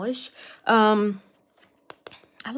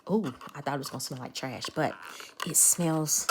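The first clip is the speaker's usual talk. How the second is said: ordinary delivery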